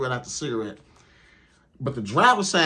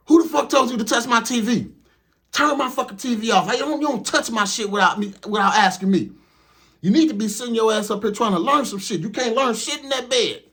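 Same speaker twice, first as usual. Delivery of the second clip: angry trainer; 7 semitones higher than usual. Retold or imitated. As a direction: chew him out for touching the TV. Trainer scolding about TV.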